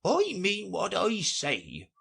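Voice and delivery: high, slow, huffy insistence; wounded dignity, slowly; offended tone. A narrator's reading. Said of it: "replied in an offended tone".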